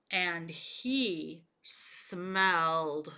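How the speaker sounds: slow, low suspense before the reveal; suspense pause. Shown as a demonstration. Teacher pausing so children guess what comes next, slow and low. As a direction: drag it out, leave them hanging. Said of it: "What do you think".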